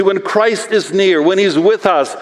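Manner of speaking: ordinary talk, nothing marked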